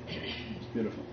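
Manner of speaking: whispered in wonder; awed whisper. Real, not acted. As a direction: breathe it out in awe. Awed whisper, then repeated.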